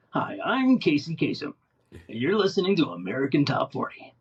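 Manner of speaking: radio countdown host voice with swooping pitch; Casey Kasem. Acted. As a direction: put on an old-school chart-show DJ delivery. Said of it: "the Casey Kasem voice".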